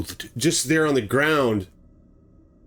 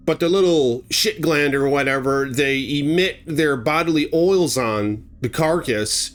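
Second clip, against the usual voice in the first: ordinary delivery, nothing marked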